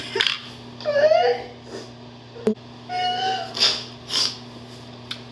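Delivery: high breathless laugh-sob; laughing and crying at once. Real, not acted. Shock at positive test.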